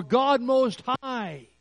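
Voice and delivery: slow booming exalted title; grand invocation. Retold or imitated. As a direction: call it out like a solemn title.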